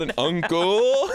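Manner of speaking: UNCLE stretched slow and high with a gliding vowel; mimicked diphthong. Teasing a guest's pronunciation, UNCLE drawn out with a mock diphthong. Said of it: "why was there a diphthong on that".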